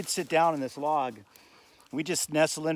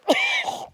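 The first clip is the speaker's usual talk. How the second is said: coughing fit; 4 dB louder than usual. Real, not acted. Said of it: "God, I ate a bug".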